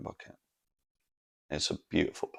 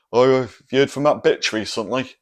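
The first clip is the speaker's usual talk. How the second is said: louder, higher, crass mock question; mock tactless mate; 6 semitones higher, 11 dB louder than usual. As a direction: ham up the blunt mate. Mates asking about his ex.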